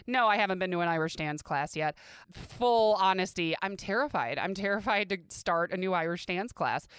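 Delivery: normal talking voice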